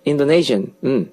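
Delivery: ordinary delivery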